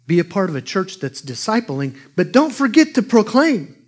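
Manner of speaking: rising to a high loud call on proclaim; preaching. Acted. Preacher exhorting the church to proclaim, building loud. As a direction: drive it home.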